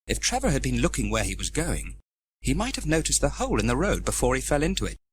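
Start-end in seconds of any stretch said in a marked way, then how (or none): none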